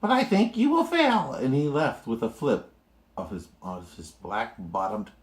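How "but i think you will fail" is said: much higher mocking taunt; sneering bird voice; sneering eagle. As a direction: taunt him as you fly off. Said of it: "sneered the bird".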